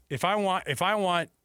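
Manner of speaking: usual speaking voice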